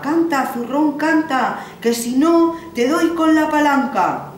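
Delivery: loud, high bellow at the sack; threatening shout. Acted. Old man threatens the magic sack, loud high shout. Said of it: "cogía el palo y empezaba".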